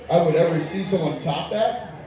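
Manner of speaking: normal talking voice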